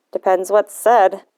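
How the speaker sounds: put-on a touch of a suspicious old man; grumpy old man. Voices grumpy grandfather William.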